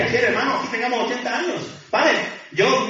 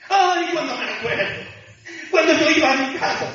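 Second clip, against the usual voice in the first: higher-pitched mock lament; acted nostalgic lament; 7 semitones higher than usual. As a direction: act out the psalmist sighing over better days. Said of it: "El salmista que dice en un momento". (lamento nostálgico fingido, en tono agudo)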